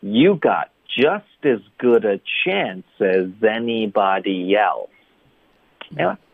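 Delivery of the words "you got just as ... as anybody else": whole sentence slow and linked, "as" weakened; fully linked speech. Shown as a demonstration. Teacher modelling the sentence start to end, linked speech. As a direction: slowly run every word into the next. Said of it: "所以从头到尾就是，".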